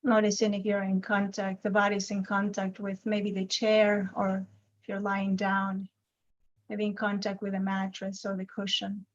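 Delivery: unhurried meditation guide with long silences between phrases; guided meditation. Acted. Teacher guiding a group's attention to the body, calm guided meditation. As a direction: gently lead them into noticing the body, leaving lots of space.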